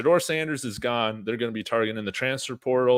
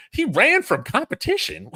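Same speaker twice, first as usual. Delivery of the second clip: squeaky mock whine, much higher; mocking critics; 3 dB louder than usual. Streamer mocking fans who say the recruit ran from competition, in a squeaky mock whine. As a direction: parrot the haters in a silly high voice.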